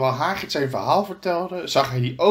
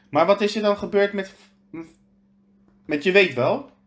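put-on higher, eager boy's question; young Harry; 7 semitones higher, 3 dB louder than usual. A narrator's reading. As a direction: ask like a curious boy. Harry asks Hagrid. (Harry vraagt, hoger en gretig)

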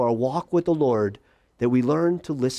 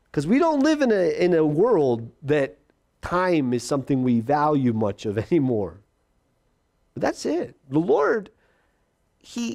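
ordinary delivery

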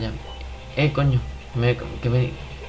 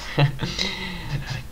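laughing; 4 semitones higher, 10 dB quieter than usual. Real, not acted. Laughs at the win.

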